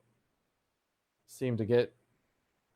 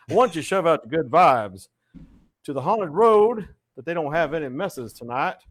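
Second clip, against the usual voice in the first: louder, higher radio DJ; 4 semitones higher, 6 dB louder than usual. Shown as a demonstration. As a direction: pump it up like a radio host. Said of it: "I sound like a radio D J".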